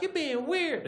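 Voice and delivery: high, prissy woman's voice; high offended girl; girlfriend voice. Acted. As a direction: squeak it like a put-off date. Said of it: "she's like".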